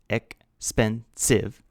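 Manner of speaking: "expensive" drawn out slowly, each syllable clear. Shown as a demonstration. Teacher slowly sounds out "expensive" for students, slow and clear. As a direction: spell out "expensive" slowly for learners. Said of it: "is three syllables".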